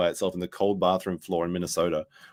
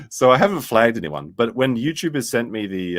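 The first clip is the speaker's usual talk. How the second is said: usual speaking voice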